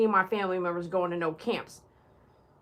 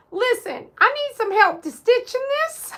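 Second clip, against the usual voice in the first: much higher, louder pleading in character; mock needy voice; 12 semitones higher, 11 dB louder than usual. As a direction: beg loudly in a silly put-on voice. Character voice asking help.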